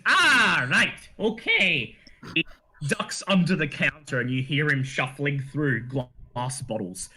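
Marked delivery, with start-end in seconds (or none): 0.1-1.9 gnome shopkeeper. Acted. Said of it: "He says".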